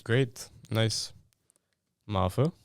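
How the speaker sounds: ordinary talk, nothing marked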